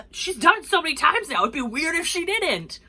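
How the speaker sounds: high, loud mock exclamation; exclaiming in amusement. Friends joke about roommate's routine accident, loudly exclaiming. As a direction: pitch it up and exclaim it.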